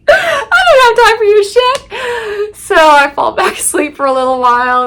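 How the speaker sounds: very high, loud laughing squeal; helpless laughter. Real, not acted. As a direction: squeeze the words out while cracking up. Cracking up at her own story.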